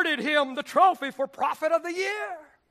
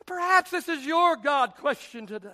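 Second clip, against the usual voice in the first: normal talking voice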